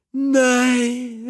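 wife hearing of the sheep; delighted cry. A narrator's reading. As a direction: cry out pleased. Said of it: "ropte kona".

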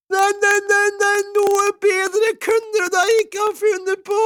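very high, loud squealed exclamation; overjoyed cry. A narrator's reading. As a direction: cry out beside yourself with joy. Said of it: "ropte kona".

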